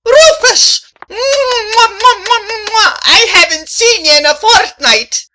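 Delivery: high, loud squealing delight with kisses; gushing greeting. Acted. Katie greets her beloved Rufus with squealing kisses. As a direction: smother him in kisses.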